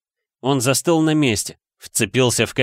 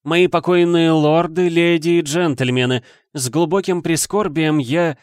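raised, higher-pitched public address; formal oration; 7 semitones higher, 3 dB louder than usual. Acted. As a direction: open a solemn speech to the room. Nick opens his speech. (торжественно, ораторски)

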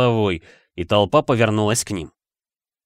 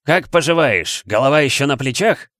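hearty, higher-pitched roar; 4 semitones higher, 3 dB louder than usual. A narrator's reading. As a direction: bellow a boisterous greeting. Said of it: "взревел он". (громогласно, с напором)

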